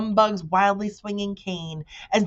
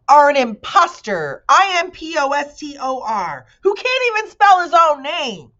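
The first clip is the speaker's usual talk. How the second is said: much higher, loud indignant cry; excited shout; 10 semitones higher, 8 dB louder than usual. A narrator's reading. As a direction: spell it out in outrage. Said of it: "shouted the bee excitedly".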